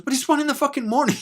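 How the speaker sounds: high, loud mock grumble; grumpy vet. Vet woken at one in the morning, loud indignant complaint. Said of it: "he goes".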